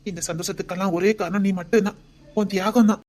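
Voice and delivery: ordinary talk, nothing marked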